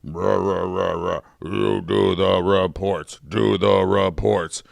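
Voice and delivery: low, slow droning boss. Pretend. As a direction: bark orders like a dull boss. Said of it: "you be the boss".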